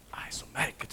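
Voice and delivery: whispered wonder; awed whisper. A narrator's reading. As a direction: breathe it in amazement. Marvelling at the strange sight.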